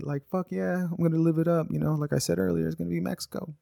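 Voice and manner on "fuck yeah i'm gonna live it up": put-on high-pitched kid's cheer; excited kid. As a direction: whoop it up like an eager kid. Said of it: "I was excited".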